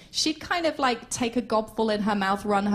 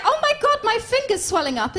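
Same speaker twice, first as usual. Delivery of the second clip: mock fretting; 10 semitones higher, 4 dB louder than usual. Acts menopause fretting.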